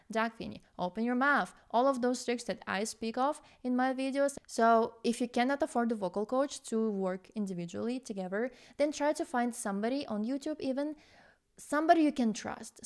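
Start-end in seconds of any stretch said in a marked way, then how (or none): none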